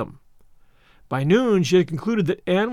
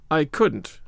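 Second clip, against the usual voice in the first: grim; 2 semitones lower than usual. A narrator's reading. Marilla refusing to imagine being an aunt, grim. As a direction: flatly shut the idea down. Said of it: "said Marilla grimly".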